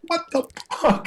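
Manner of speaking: high, squeaky retold disbelief; amazed disbelief. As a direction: imitate your own astonishment, high in pitch. Recalls amazement at the chance.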